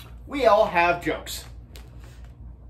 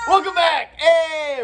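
shouting; 10 semitones higher, 7 dB louder, slower than usual. Real, not acted. Opening shout to camera.

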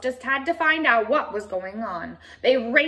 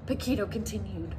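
usual speaking voice

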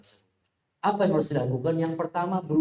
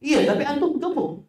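much higher, needling comeback; mocking retort; 12 semitones higher than usual. Imagined mocker insists again, high and needling. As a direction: toss the insult back like a teasing kid. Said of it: "Kira-kira dia akan jawab apa".

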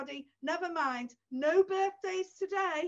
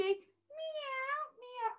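high, soft mock meow; high, soft cat meow for the puppet; 10 semitones higher, 8 dB quieter than usual. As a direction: mew like a little kitten.

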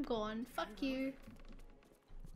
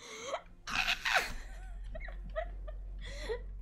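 high, shrill burst of exasperated laughter; a real laugh of despair at the game; disbelieving laugh; 9 semitones higher, 5 dB quieter than usual. Real, not acted. Laughs after the disaster.

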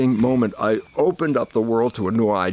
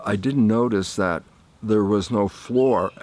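ordinary talk, nothing marked

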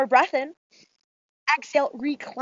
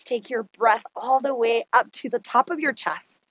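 ordinary talk, nothing marked

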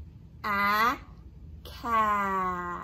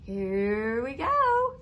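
excited; 8 semitones higher, 6 dB louder than usual. Acted. Teacher hyping the children.

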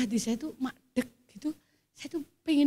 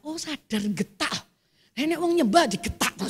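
ordinary talk, nothing marked

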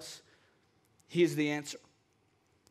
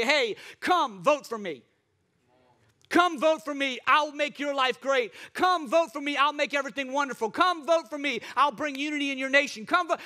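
mock campaign pitch; 6 semitones higher than usual.

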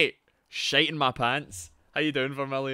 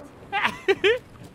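short squeaky burst of laughter; high-pitched amused cackle; 3 dB louder than usual. Real, not acted. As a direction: giggle high at yourself. Laughs about having screamed.

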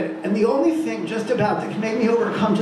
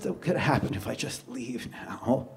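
hushed inner thought; 8 semitones lower, 10 dB quieter than usual. Acted. Teller voices his private thought.

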